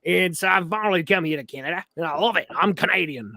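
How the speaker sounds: put-on Australian voice; Australian accent. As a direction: play an eager Australian newcomer. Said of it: "this guy came in from Australia".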